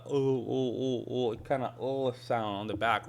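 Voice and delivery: "oh" as the dark back sound of a double L; dark heavy L. Shown as a demonstration. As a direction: repeat "oh" from the back of the mouth. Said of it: "make it a little bit heavier".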